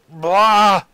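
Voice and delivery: loud, high "blah" outburst; frustrated yell. Real, not acted. Player who can't even with Vikings, frustrated yell. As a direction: blurt a big wordless noise of frustration.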